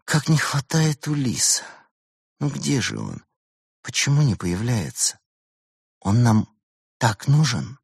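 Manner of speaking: sorrowful, wistful pleading; sad. A narrator's reading. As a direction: lament someone who is missing. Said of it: "А Берта грустно проговорила".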